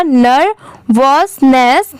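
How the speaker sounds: Nervousness very slowly as ner, vos, ness; drawn-out nervousness, a pause after each syllable. Shown as a demonstration. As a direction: break nervousness into three spaced parts. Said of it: "पूरा मिला देंगे".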